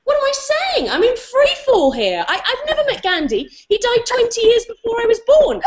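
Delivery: high, frantic thoughts with a laugh; reliving her own panicked inner monologue. Retold or imitated. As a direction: spiral in mock panic. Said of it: "thought to myself".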